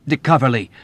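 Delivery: loud indignant exclamation; young officer accusing the old man; horrified indignation. A narrator's reading. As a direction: accuse him, appalled. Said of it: "he exclaimed in horrified indignation".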